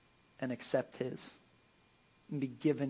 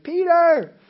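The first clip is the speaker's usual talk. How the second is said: high loud rebuke of a name; exasperated call; 12 semitones higher, 9 dB louder than usual. Retold or imitated. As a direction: call him out, fed up. Jesus rebuking Peter.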